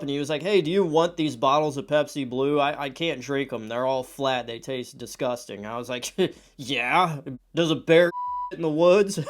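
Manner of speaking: usual speaking voice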